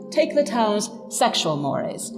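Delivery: ordinary delivery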